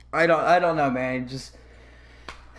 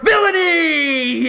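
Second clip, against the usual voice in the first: hammed-up villain; 8 semitones higher, 5 dB louder, much slower than usual. Mocking Lena turning villain.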